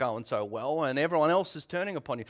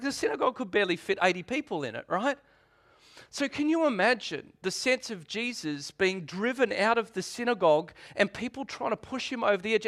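ordinary talk

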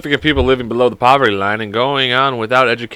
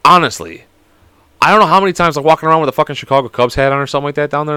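usual speaking voice

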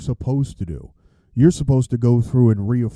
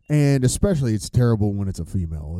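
ordinary delivery

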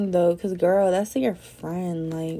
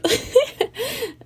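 short high-pitched giggle; high laugh. Real, not acted. Laughs at own joke.

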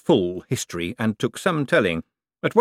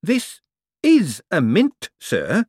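put-on patient, slow, higher "This is a mint" as to a child; talking to a child; 10 semitones higher, 4 dB louder, much slower than usual. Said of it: "like a man talking to a child".

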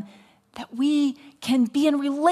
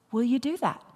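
normal talking voice

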